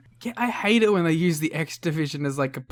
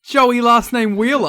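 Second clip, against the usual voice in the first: excited; 8 semitones higher, 10 dB louder, slower than usual. Real, not acted. Co-host still excited after the reading.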